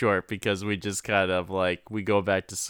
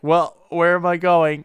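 higher and louder confused cry; mock panicked yell; 7 semitones higher, 6 dB louder than usual. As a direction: holler it, lost and flustered. Character panicking retold.

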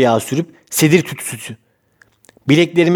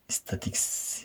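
whispering; 9 semitones lower, 23 dB quieter, much slower than usual. Real, not acted. Whispers while fumbling the word.

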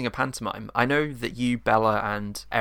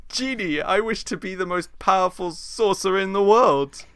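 pantomime villain; 10 semitones higher, 5 dB louder than usual. Acted. Plays villain Jafar.

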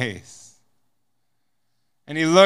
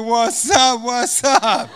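higher and louder, laughing hello; excited greeting; 6 semitones higher, 4 dB louder, much slower than usual. Retold or imitated. Two American prisoners greeting each other, laughing and excited. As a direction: trade giddy greetings.

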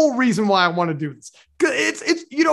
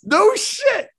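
surprised; 5 dB louder than usual. Real, not acted. Surprised reaction to story.

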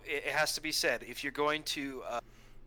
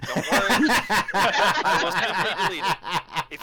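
laughing; 3 semitones higher, 8 dB louder, slower than usual. Real, not acted. Laughing through a cheeky threat.

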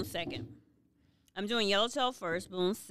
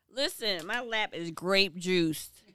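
ordinary talk

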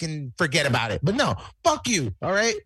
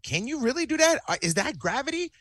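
acted disbelief; 8 semitones higher than usual. Acts out own disbelief watching moves.

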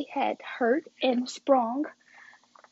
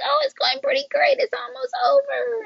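high, loud, overdone cheerful act; fake cheerful; 14 semitones higher, 4 dB louder than usual. Daughter lying to dad about the movie, fake cheerful. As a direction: pretend all is fine, pitched way up.

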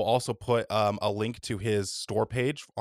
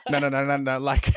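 high giggling no no no; cracking up, high and loud; laughing; 3 semitones higher, 5 dB louder than usual. Real, not acted. Cracking up at joke.

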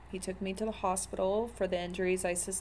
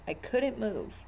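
normal talking voice

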